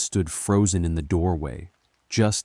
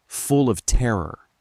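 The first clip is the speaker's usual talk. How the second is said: usual speaking voice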